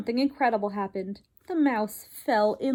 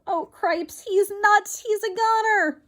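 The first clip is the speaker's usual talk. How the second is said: high, louder panicked mouse; panicked shout; 11 semitones higher, 5 dB louder than usual. Acted. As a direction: yell it in a rising panic. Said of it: "shouted Furlough".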